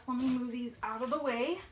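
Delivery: usual speaking voice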